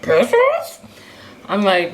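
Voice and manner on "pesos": high, loud cartoon dog asking in disbelief; Scooby-Doo voice. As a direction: squeak it like a baffled cartoon dog. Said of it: "my Scooby-Doo voice".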